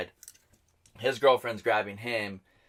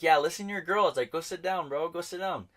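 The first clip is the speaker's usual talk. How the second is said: retold yelling; 8 semitones higher than usual. Telling a rival to sit down, retold yelling. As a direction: order him off in a mock yell. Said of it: "yelling at him".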